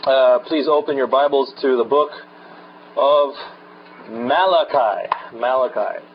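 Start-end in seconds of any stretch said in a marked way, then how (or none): 4.1-6.0 Malachi ending in a long "eye", MAL-uh-kye; measured "MAL-uh-kye" for Malachi; correct pronunciation. Shown as a demonstration. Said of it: "It is not pronounced Malachi".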